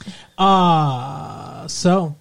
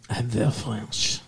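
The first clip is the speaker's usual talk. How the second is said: lower, mock French accent; 4 semitones lower, 5 dB quieter than usual. Boyfriend teasing new fiancée in a mock French accent. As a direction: put on a silly French accent. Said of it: "my French accent".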